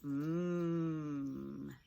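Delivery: MM as one long, low hum; letter sound. Shown as a demonstration. Teacher showing the new ending consonant, long hummed letter sound. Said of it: "to an M".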